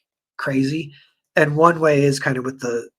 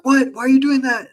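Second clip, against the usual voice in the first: high, raised-voice startled demand; mock outraged king; 11 semitones higher, 6 dB louder than usual. King questioning the guy who gave the sword, mock outrage. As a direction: imitate a king caught off guard.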